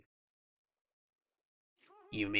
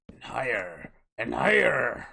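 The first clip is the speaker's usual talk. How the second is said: rising louder; 3 semitones higher, 9 dB louder than usual. Acted. Building boat upward.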